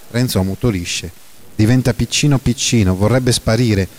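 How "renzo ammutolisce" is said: ordinary delivery, nothing marked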